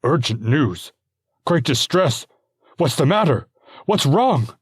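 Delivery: a frightened husband firing questions; husband alarmed by news of his wife's distress; gasping alarm. Acted. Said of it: "he gasps".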